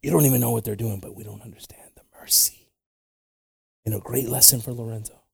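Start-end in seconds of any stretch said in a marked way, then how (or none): 1.0-2.7 whispered aside. Real, not acted. Preacher confiding to the congregation, whispered. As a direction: drop to a whisper.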